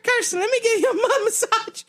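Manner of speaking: high put-on voice offering his wife a massage; mock sweet husband. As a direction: play the eager husband sweet-talking.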